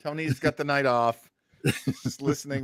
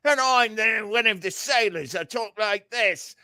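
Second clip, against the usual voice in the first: sailor voice pitched higher; a cartoonish deckhand, higher up; mock sailor voice; 8 semitones higher than usual. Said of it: "My favorite is like".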